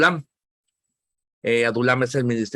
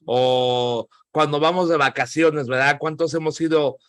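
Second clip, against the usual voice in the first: usual speaking voice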